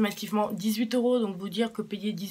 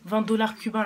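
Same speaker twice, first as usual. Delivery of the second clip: loud, higher repeat for someone hard of hearing; calling out; 4 semitones higher, 10 dB louder than usual. Real, not acted. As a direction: repeat it loudly so dad hears. Répète pour son père sourd. (répéter en criant)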